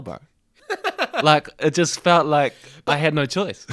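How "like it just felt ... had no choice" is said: laughing. Real, not acted. Laughing at his own embarrassing story.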